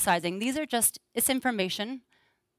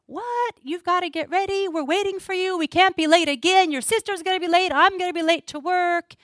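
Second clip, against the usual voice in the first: much higher, exasperated nagging; irritated mom; 9 semitones higher than usual. Retold or imitated. Mother scolding her late daughter before school, exasperated and high. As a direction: hurry her up, fed up. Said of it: "So I'm like".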